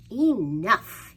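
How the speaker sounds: loud exasperated shout; loud royal outburst; king's shout. Acted. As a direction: bellow it like a fed-up king. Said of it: "he said".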